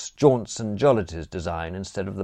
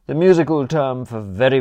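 ordinary delivery

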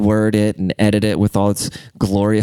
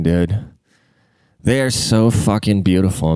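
usual speaking voice

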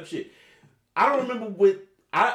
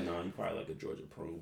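muttered under his breath, much softer and lower; inner thought; 10 semitones lower, 15 dB quieter than usual. Retold or imitated. Joking inner reply to the stripper, under the breath. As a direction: say it quietly like a private thought. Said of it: "I said that in my head".

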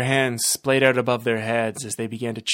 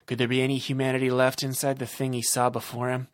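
plain reading voice

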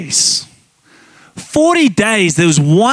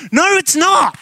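a much higher, shouted refusal; firm shout; 9 semitones higher than usual. Real, not acted. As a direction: shut the excuse down hard. Preacher rebuts mock excuse.

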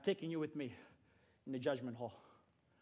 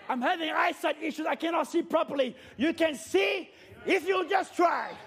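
preaching at full force; 5 semitones higher than usual. Real, not acted. Preacher urging the church to try, full-force preaching. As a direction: drive it home to the congregation.

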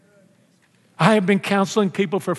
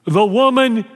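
whiny blame; 3 dB louder than usual. Acted. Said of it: "First person he blames is God".

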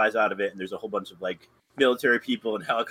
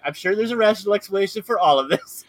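higher, louder, overly sensible neighbour; mock-calm reassurance; 9 semitones higher, 5 dB louder than usual. Neighbour insists nothing is odd, hammed-up reassurance. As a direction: play it like she's pretending all is normal. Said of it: "And Geraldine's like".

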